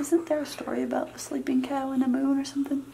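soft whispered question; whispering over a crib. Real, not acted. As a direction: whisper so she stays asleep. Dog asleep.

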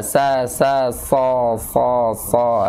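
sa, sa, so, so drilled slowly; sad drill. Shown as a demonstration. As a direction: model the sad sound syllable by syllable. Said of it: "Latihannya gitu".

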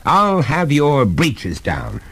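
angry, insistent scolding; shouting father. A narrator's reading. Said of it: "shouted his father after him".

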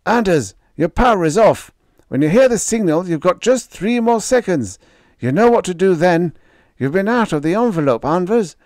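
high, loud, fast instructions; urgent ground control. Acted.

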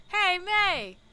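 loud, high call. Acted. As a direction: call down to a friend. Calling to friend below.

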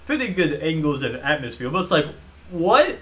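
usual speaking voice

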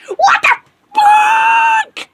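very high, loud, drawn-out shriek; hammed-up outraged screech; outraged scream. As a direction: scream it slowly at full pitch. Customer's outburst after refusal.

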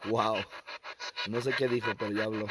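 low, soft murmur of amazement; hushed aside. Real, not acted. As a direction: whisper your reaction so you don't scare it off. Hushed aside after a response.

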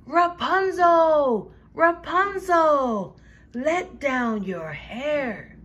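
high, slow call that fades on the last words; prince calling up. Acted. Prince calling up to Rapunzel, slow and loud then easing. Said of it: "the prince called out".

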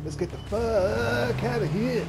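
high, loud, drawn-out holler; stretched-out, high-pitched getaway shout; urgent yell. Real, not acted. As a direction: yell it long and high to get everyone moving. Rallying the team to flee.

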